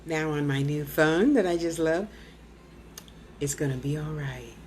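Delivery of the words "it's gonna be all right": low, soft, reassuring voice message; Al's soothing voice. Retold or imitated. Voicing Al's message to her, low and soothing. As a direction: soothe her gently like a calm friend. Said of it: "He'll leave the voice thing".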